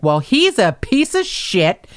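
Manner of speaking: high, drawn-out contempt; angry retort. Retold or imitated. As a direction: spit out a slow, indignant verdict. Retold angry retort about detective.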